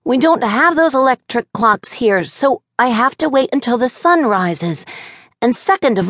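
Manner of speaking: put-on high, earnest little boy; child voice. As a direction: list his points like a six-year-old.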